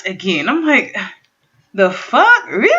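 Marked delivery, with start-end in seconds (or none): 1.7-2.7 mock disbelief, pitched up; retold reaction of disbelief; disbelieving. Acted disbelief at the bad song.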